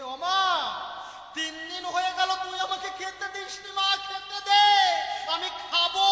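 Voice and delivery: put-on loud, high pleading wail of a hungry child; child's shout. Hungry child Harichand shouting at his mother for food. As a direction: cry out for food, slowing right down on খেতে দে.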